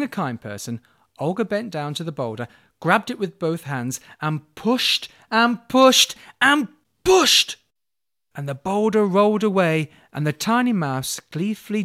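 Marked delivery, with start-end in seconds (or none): 4.2-7.6 slow, higher, louder straining push; straining effort. A narrator's reading. Narrator pushing the boulder with Olga, straining.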